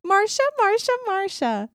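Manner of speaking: high whiny Marsha chant; sitcom whine. Retold or imitated. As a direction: whine it like the jealous sister. Quoting famous sitcom whine.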